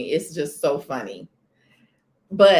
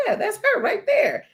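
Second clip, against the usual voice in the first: laughing retort. Retold or imitated. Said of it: "I was like".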